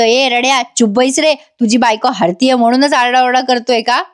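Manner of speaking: ordinary talk, nothing marked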